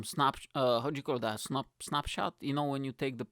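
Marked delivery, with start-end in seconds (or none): none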